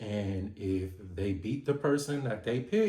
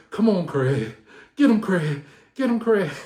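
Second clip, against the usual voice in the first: high, loud mock egging-on; hammed-up cheering; 8 semitones higher, 10 dB louder than usual. Quoting onlookers urging Craig on in Friday, hammed-up cheering. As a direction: yell him on, playing it up.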